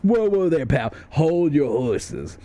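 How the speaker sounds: a stretched-out hold your horses; mock police officer. Officer of the law stopping you, mock slow drawl. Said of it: "and he goes".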